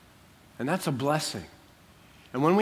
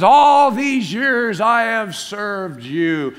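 loud, high, stretched-out mock grievance; hammed-up protest of a slighted son; resentful complaint; 6 semitones higher, 8 dB louder, much slower than usual. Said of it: "in the tone of what he's saying".

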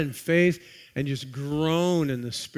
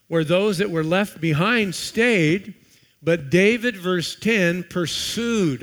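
louder, declaiming King James verses; preached scripture reading; 3 semitones higher, 7 dB louder than usual. Acted. Pastor reading the David passage to the church, declaimed. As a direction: proclaim the verses to the congregation.

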